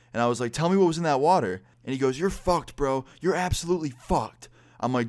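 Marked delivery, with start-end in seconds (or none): 0.5-1.6 retold angry demand. Acted confrontation over the water. 2.2-4.5 high, heated bro voice; imitated angry threat. Soaked host threatening his guest, angry.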